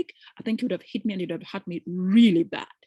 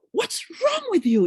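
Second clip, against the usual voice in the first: angry husband; 12 semitones higher, 5 dB louder, slower than usual. Retold or imitated. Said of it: "he was hitting, he said".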